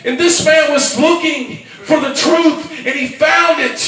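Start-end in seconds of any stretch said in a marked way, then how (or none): 0.0-2.8 preaching at full force. Acted. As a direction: drive it home to the congregation.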